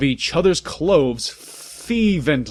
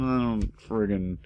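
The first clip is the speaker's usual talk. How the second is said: plain reading voice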